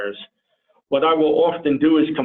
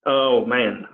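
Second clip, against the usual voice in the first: brain freeze; 2 semitones lower, much slower than usual. Real, not acted. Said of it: "I'm having a brain freeze right now".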